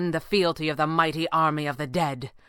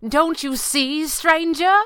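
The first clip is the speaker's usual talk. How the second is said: high, slow, ringing triumph; drawn-out exultant cry, climbing higher; 11 semitones higher, 5 dB louder, slower than usual. A narrator's reading. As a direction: let it ring out with exultation. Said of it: "rang out in something like exultation".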